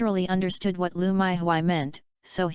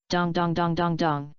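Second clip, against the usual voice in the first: knocking sound. A narrator's reading. Knock on the door.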